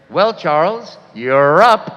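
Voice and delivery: loud call across the class; called out loudly. Retold or imitated. Teacher calling the shy student up, loud.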